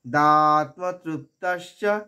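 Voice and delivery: "দাত্মতৃপ্তস্য" slowly, long "দা", struck consonants; stressed t. Shown as a demonstration. Teacher drilling the compound, slow "দাত্মতৃপ্তস্য". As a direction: intone "দাত্মতৃপ্তস্য" cluster by cluster. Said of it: "তয়ের আঘাত".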